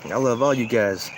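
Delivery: usual speaking voice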